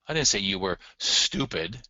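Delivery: STUPID punched at the end; even lead-in, all the weight landing on "stupid"; stress on stupid. Shown as a demonstration. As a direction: run up to "stupid" and hit it. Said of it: "How would you read this sentence".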